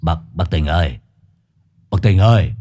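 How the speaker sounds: drawling, slurred calling; slurred drunk voice. A narrator's reading. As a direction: call out, slurring the words. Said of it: "cái giọng lè nhè đơ đớ".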